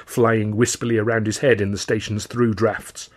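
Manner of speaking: ordinary delivery, nothing marked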